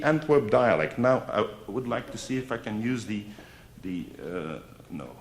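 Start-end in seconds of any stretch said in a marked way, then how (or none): none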